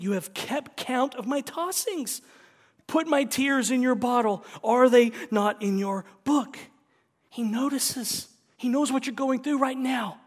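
loud, high impassioned preaching; fiery sermon voice; preaching at full force. Acted. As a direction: proclaim it like a pulpit cry.

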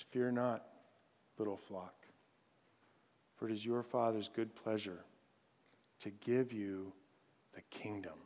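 very soft and low, fading with pauses; sinking to a near murmur; hushed recitation. Shown as a demonstration. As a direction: let the verse settle quietly, phrase by phrase. Closing recitation of meditated verse.

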